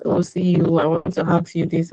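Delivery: normal talking voice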